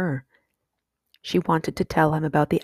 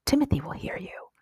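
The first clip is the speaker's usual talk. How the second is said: softer, half-whispered caution; hushed warning; 3 semitones higher, 5 dB quieter than usual. A narrator's reading. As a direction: keep it down so he won't hear. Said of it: "Not so loud".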